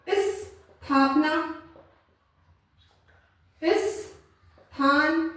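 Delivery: स्थापना, स्थान slowly, "is" held apart from the rest; half s. Shown as a demonstration. Teacher giving pupils examples, स्थापना, स्थान split after "is". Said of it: "इस मतलब आधा स है".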